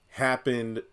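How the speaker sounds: usual speaking voice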